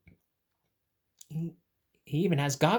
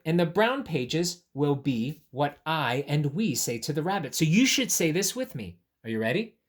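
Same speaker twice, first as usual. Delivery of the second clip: plain reading voice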